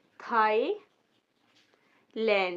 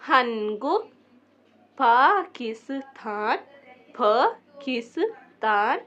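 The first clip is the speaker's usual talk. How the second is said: louder, carefully articulated word list; pronunciation review; 5 dB louder, slower than usual. Shown as a demonstration. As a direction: read each word out clearly for learners. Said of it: "दोबारा pronounce करके दिखा देती हूँ".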